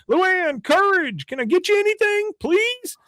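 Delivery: high, eager call; imitating a fawning man, high; guy below calling up. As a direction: pitch it way up and fawning.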